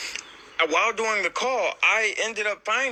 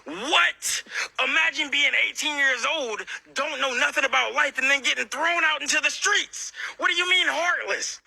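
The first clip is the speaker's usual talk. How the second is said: indignant, high-pitched outburst; retold heated protest at an accusation; raised voice; 7 semitones higher than usual. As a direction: argue back in a higher, outraged voice. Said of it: "who was I raising my voice to".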